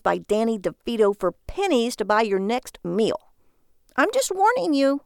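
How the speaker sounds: high, emphatic nagging wife; exasperated rant. A narrator's reading. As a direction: hammer home every word, building to a warning. Wife rants and threatens divorce.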